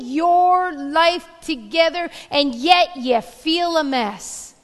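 loud, slow pulpit proclamation; preaching. Acted. Preacher thundering to his congregation, slow and loud.

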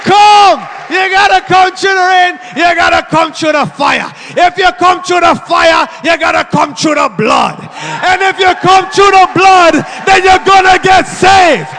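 loud, high Jamaican preacher's chant; building, full-force Jamaican-accented call. Retold or imitated. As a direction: shout the challenge in a put-on Jamaican accent. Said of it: "without a Jamaican accent".

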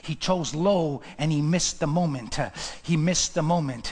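low and measured, gradually firming up; grave, subdued preaching. Real, not acted. Preacher lamenting Esau's low choice, low and grave. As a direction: bring it right down, then press the point.

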